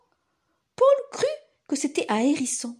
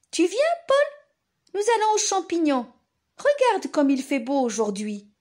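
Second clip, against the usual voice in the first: parent voice; 6 semitones higher, 4 dB louder than usual. Acted. Parents invite Paul, voiced.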